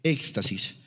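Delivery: éxtasis said slowly, stress on the first E, with an X sound; careful spelling-out pronunciation. Shown as a demonstration. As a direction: spell it out by ear: éxtasis, ÉX-ta-sis. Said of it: "con tilde en la E y con X, sin H".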